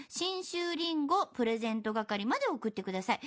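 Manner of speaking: usual speaking voice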